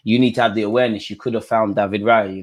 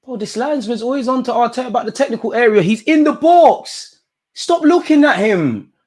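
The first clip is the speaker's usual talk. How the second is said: outraged rant; 12 semitones higher than usual. Real, not acted. Fan outraged at the linesman watching Arteta, high indignant rant.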